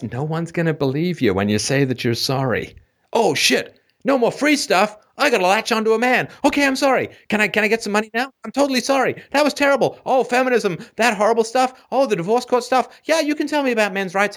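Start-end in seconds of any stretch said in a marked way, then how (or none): 3.1-10.0 high whiny mock woman. Mocking women asking for money. 10.1-14.4 high whiny mock woman.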